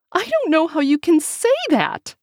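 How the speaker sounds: high, loud panicked protest. A narrator's reading. Wife refusing a baby, high panicked protest. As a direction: alarm rising, she can't believe it.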